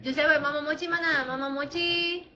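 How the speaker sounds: ordinary talk, nothing marked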